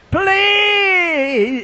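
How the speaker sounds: high drawn-out wail, sliding down; crying voice. Retold or imitated. Lady praying by the altar, mock crying wail. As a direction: imitate a long, pleading cry. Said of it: "a crying voice".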